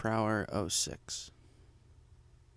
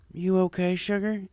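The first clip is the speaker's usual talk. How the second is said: concerned; 7 semitones higher than usual. A narrator's reading. AJ comforts.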